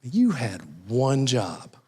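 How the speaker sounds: slow, deliberate mock reproach; groom's mother scolding. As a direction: play the exasperated mom, spacing out each word. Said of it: "the mom of the groom's kinda going".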